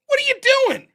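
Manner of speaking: very high, loud disbelieving question; catching your uncle in the act; shocked question. Retold or imitated. Finds his uncle at window.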